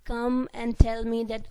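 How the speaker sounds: usual speaking voice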